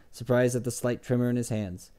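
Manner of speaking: ordinary delivery, nothing marked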